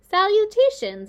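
a tiny spider greeting from above; small spider voice. A narrator's reading. A baby spider greets Wilbur from the doorway, small and high. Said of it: "when he heard a small voice".

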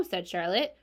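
ordinary delivery, nothing marked

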